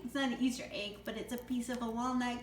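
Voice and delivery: usual speaking voice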